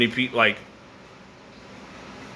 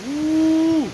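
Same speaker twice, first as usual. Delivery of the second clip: high whooping cheer; excited whoop; 3 dB louder than usual. Real, not acted. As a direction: let out a whoop. Cheering at the wind.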